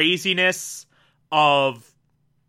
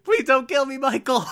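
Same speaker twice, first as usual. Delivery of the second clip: high, desperate plea through a laugh; begging for my life; 7 semitones higher than usual. Retold or imitated. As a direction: beg him to spare you. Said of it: "every time she does the whole beg for her life thing".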